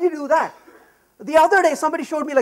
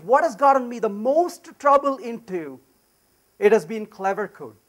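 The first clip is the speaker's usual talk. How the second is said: ordinary talk, nothing marked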